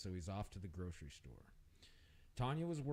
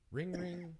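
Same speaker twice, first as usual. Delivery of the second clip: sing-song ring ring, pitched up; phone ring; 9 semitones higher, 5 dB louder than usual. Acted. Chirpy imitation of a phone ringing. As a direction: narrator imitating the phone before Eva answers, sing-song.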